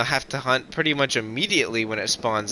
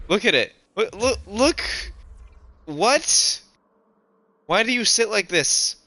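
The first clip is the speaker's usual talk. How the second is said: baffled; 9 semitones higher than usual. Real, not acted. Baffled at dinosaur pose.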